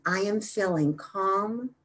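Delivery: slow, soft meditative affirmation; unhurried mantra with long final words; slow repetition. Shown as a demonstration. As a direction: let each phrase settle, drawn out and hushed. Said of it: "And then slowly repeat".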